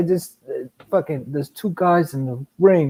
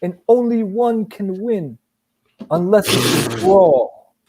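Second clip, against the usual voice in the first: slow, higher mock commentator voice; 6 semitones higher, slower than usual. Mocking the commentator's pointless remark, slow and high. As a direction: drag it out like a clueless pundit. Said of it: "I was like".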